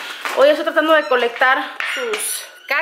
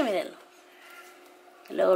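normal talking voice

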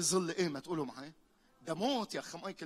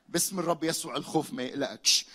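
normal talking voice

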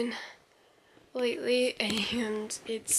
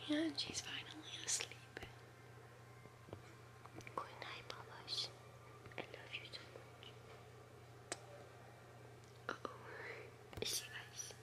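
whispering over a crib; 3 semitones lower, 14 dB quieter than usual. Real, not acted. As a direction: hush the goodnight over the crib.

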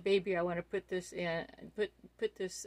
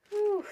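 a sharp, high, loud whew of breath; overwhelmed exhale; 14 semitones higher, 8 dB louder than usual. Real, not acted. Unboxing gifts from friend Jan, breathless whew. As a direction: blow out the air, breathless with delight. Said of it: "I gotta catch my breath".

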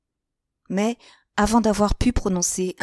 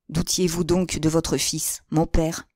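usual speaking voice

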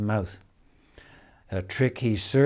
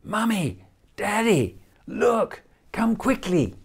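put-on much higher, excited children calling out; children shouting; 10 semitones higher than usual. A narrator's reading. Children call their parents from the bathtub, high excited call. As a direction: call the parents like thrilled kids. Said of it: "they began shouting".

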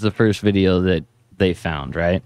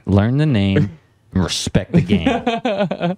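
mock tough slogan; 8 semitones higher, much slower than usual.

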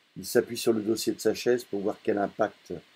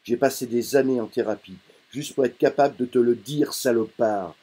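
loud, higher angry outburst; shouting; 4 semitones higher, 8 dB louder than usual. Acted. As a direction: yell it at his father after years of therapy. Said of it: "Je hurle".

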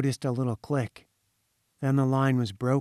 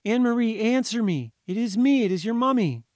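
higher, louder urgent plea; pleading call; 9 semitones higher, 4 dB louder than usual. A narrator's reading. Mother begging her daughter to answer, pleading call. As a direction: coax the child to speak. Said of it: "she called".

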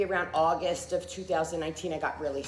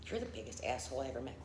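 quiet retold insult; 3 semitones lower, 9 dB quieter, faster than usual. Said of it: "and said".

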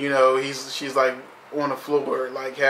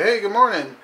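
cheery greeting; 7 semitones higher, 3 dB louder than usual. Retold or imitated. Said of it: "I'm like".

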